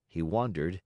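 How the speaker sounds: ordinary delivery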